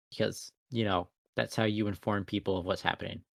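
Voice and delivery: low, softened passive-aggressive dig; quiet, pointed sarcasm. Real, not acted. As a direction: mutter it pointedly with falling pitch. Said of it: "I'm being very passive aggressive, aren't I?".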